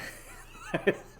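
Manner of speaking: airy silent laugh; breathy unvoiced laugh. Real, not acted. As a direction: laugh under your breath. Host laughs at his own intro.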